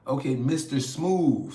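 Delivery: Mister Smooth said slow, loud and high, each word leaned on; corrected name stressed. Real, not acted. Correcting a viewer's misread name, emphatic and slow. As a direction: correct yourself firmly and slowly on Mister Smooth. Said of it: "I keep saying".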